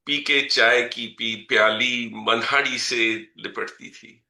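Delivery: measured, rhythmic reading of verse; poem recitation. Acted. Poet reciting a poem about his mother to the audience, measured recitation. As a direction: recite each line and let it land.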